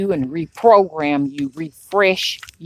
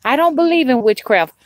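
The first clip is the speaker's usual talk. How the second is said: mocking quote; 6 semitones higher than usual. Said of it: "They even say".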